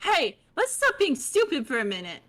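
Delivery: high bubbly chatty voice; high fish girl voice. Acted. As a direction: chirp it like a bubbly troll. Holds character voice.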